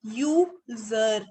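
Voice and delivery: यूजर drawn out slowly, the opening "यू" like "you"; slow teacher demo of यूजर; sounding out the word. Shown as a demonstration. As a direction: stretch यूजर so the "यू" sound stands out. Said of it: "sound देखो आप उसकी".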